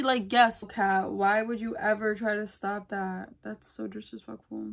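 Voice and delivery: ordinary talk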